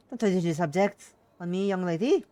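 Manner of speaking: loud, stern mum voice; forceful motherly reprimand; scolding mother. Acted. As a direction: tell off your daughter down the phone. Mother scolds daughter on the phone.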